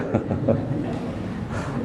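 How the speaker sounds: breathy unvoiced chuckle; soft laugh. Real, not acted. Preacher chuckling at his own fable, soft laugh. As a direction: laugh quietly at the joke.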